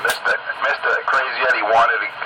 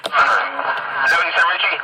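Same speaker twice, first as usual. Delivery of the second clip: pitched up, drawn-out mock question; high mock voice; 8 semitones higher, much slower than usual. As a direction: squeak it out mockingly.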